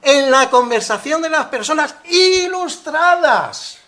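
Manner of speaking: loud, high, slow declamation; hammed-up solemn quote, each word stretched; mock-pompous reading. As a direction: proclaim it like a grand decree. Hammed-up quote from the pronunciation manual.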